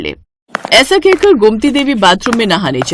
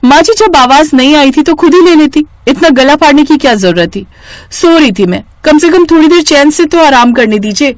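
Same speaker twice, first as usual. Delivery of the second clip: rude retort; 6 semitones higher, 5 dB louder than usual. Acted. Rude daughter-in-law snaps back.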